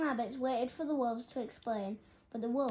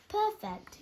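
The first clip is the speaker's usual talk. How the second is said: high yawning "Perfect"; yawned; 10 semitones higher, 4 dB louder than usual. A narrator's reading. Sleepy dormouse yawns as the lullaby plays again. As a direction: stretch "Perfect" into a sleepy yawn. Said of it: "yawned Dormouse".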